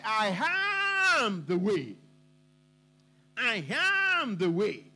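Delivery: slow, bold, with a long ringing AM; bold declaration. Retold or imitated. Preacher quotes Jesus answering his disciples, bold and unapologetic. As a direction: hold AM long and declare it without apology. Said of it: "he said boldly, without any apologies".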